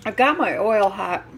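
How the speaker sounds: ordinary talk, nothing marked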